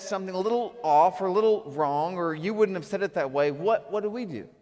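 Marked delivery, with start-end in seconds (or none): none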